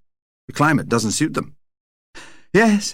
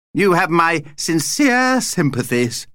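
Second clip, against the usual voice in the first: high, slow, drawn-out condolence; pompously pitying vampire; 7 semitones higher, slower than usual. A narrator's reading. Vampire commiserates with the family, pitying. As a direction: draw out the sympathy in a high voice.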